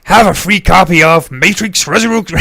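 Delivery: loud, hammed-up Bristol pirate; big, badly faked Bristol accent. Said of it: "It's a Bristol accent".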